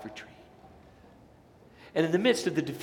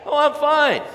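high, loud "I'm fine"; mock cheerful answer; 12 semitones higher, 10 dB louder than usual. Preacher acting a churchgoer answering, mock bright. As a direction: chirp it out falsely bright.

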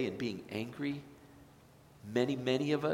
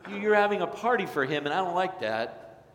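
loud, high, indignant complaint; resentful older son; 6 semitones higher, 5 dB louder than usual. Acted. Older son protesting the party, loud and resentful. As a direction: voice the bitter older brother objecting.

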